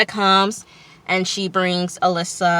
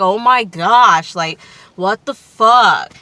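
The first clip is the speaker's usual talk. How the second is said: louder, drawn-out mock exasperation; exasperated reaction; 2 semitones higher, 6 dB louder, slower than usual. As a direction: act out disbelief, stretching the words out.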